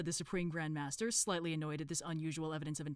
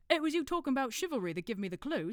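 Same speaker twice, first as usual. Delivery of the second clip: higher put-on accent for a dim cult member; Brother Watchtower; 8 semitones higher, 4 dB louder than usual. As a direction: interrupt helpfully in a put-on accent. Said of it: "decided to do an accent".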